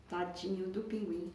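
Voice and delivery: plain reading voice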